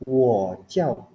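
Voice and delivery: WO falls only, before JIAO; half third tone. Shown as a demonstration. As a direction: let WO drop without lifting, then say JIAO. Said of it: "kalian boleh bilangnya turun aja".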